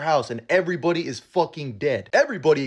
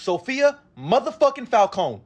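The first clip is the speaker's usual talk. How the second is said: each word slowed and hit hard, pitched up; slow emphatic reveal; 6 semitones higher, slower than usual. Real, not acted. Hyping viewers about the episode's star, slow and emphatic. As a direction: lean on every word of Sophia motherfucking Falcone. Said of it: "all I gotta say is three words".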